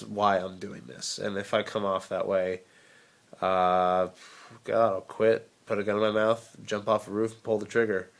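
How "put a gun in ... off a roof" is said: ordinary talk, nothing marked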